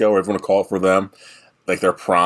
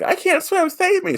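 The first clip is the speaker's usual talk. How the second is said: mock damsel in distress.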